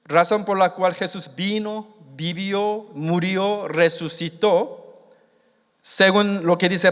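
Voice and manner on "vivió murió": ordinary delivery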